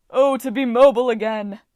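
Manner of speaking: loud, joyful cry of freedom; elated cry. A narrator's reading. Farm god escaping in his chariot cries out joyfully. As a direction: fling it out with delight. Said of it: "Trip cried".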